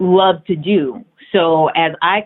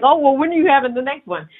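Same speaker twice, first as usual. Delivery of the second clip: high, quick mimicry of nosy people; eager asker; 8 semitones higher, much faster than usual. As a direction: playfully imitate someone pressing for news. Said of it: "Then it's like".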